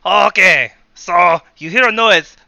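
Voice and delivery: game master's bad Russian accent; attempted Russian accent. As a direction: try out a Russian accent, pushed loud. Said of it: "that's how you start the Russian accent".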